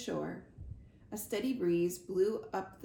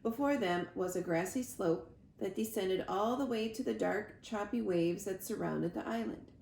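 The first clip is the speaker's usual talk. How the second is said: ordinary delivery, nothing marked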